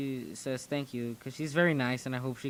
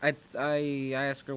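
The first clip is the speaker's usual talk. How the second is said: ordinary delivery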